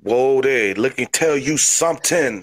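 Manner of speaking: hammed-up Cajun accent; crazy Cajun. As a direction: open a wrestling promo as a wild Cajun. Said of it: "You're a crazy Cajun".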